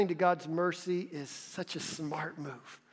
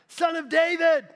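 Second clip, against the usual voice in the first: loud, high shout over the crowd; 12 semitones higher, 8 dB louder than usual. A narrator's reading. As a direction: cry out even louder than before. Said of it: "But he shouted all the more".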